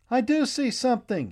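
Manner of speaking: high, loud cry of discovery; boy spotting land calls out, high and loud; excited shout. A narrator's reading. Boy at the bow shouts to everyone, loud and high. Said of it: "he shouted".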